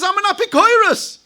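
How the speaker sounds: high, loud mock proclamation; brazen declaration. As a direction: proclaim it like an indignant, proud heretic. Sinner declares himself a heretic.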